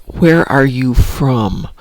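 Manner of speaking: drawn-out words at half speed; retold question, stretched out word by word; slowly repeated question. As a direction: say it slowly so it gets understood. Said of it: "after a few minutes, she's like".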